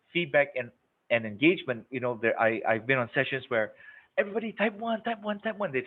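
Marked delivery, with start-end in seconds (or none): 4.2-5.7 mock pushy presenter.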